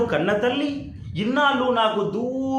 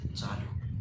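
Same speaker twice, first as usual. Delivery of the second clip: breathless whisper, voice giving out; choked whisper; 13 dB quieter, much slower than usual. Acted. Son overwhelmed by his mother's words, whispering. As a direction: barely get the word out. Overcome with emotion, voice gone.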